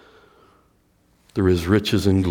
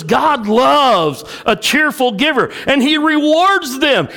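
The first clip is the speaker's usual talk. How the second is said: verse called out high and loud, slowly; proclaimed verse; 5 dB louder than usual. Shown as a demonstration. Pastor quoting Second Corinthians to the church, proclaimed loudly. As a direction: ring it out to the rafters.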